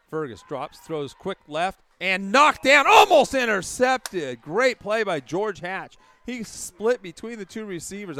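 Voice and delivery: rising to a shouted call; excited play-by-play. Acted.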